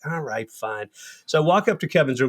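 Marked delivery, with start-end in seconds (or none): 0.1-0.9 softer, lower, defeated; resigned giving in. Retold or imitated. Jay agrees to do the group, softer and defeated. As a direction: let it drop, beaten. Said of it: "I'm like".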